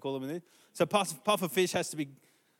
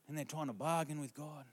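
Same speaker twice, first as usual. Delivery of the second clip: usual speaking voice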